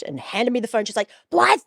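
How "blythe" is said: high, loud furious shout; mum yelling. Retold or imitated. Mother scolding teen daughter over career, furious shout. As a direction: scream the career order at her.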